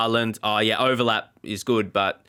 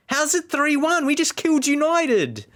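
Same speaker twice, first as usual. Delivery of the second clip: exasperated outcry; 13 semitones higher than usual. Real, not acted. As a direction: cry out at the unfair score in disbelief. Outraged at the scoreline.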